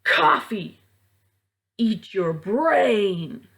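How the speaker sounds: loud, slow monster roar; dad turned wolf roaring at his son. Acted. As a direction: roar it like a rabid beast. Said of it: "The wolf roared".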